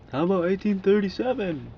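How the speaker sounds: high, loud shouted offer; calling out. Acted. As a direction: call it out to someone across the street. Imagines calling out to the women.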